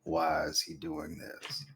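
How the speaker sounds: very low, slow growl; Vin Diesel voice. Pretend. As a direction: drag it out deep and low. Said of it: "you can actually just do Vin Diesel".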